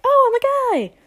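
very high, loud celebratory squeal; hammed-up big-reveal cheer; mock excited announcement. Podcaster imagining own second gender reveal, mock excited squeal.

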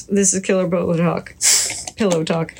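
muffled behind a hand; hand over mouth. Real, not acted. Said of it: "I had my hand over my mouth there, so it might not sound good".

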